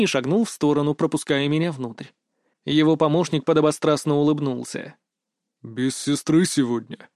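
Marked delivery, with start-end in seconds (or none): 5.6-7.1 slow voice of a big bouncer; Titan the guard. A narrator's reading. Burly guard questions a passing club member, slow character voice. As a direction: take your time, as the hulking doorman. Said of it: "неожиданно спросил Титан".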